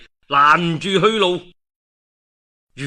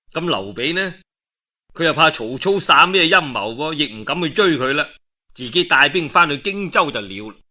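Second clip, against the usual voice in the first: ordinary delivery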